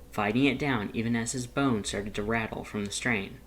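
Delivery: ordinary delivery, nothing marked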